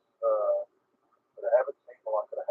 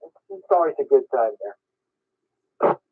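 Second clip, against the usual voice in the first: ordinary talk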